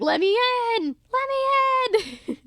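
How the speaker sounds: high, loud, drawn-out wail to be let in; desperate pleading. Pretend. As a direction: beg at the door at the top of your lungs. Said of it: "Serena's like".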